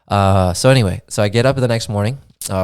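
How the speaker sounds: ordinary talk, nothing marked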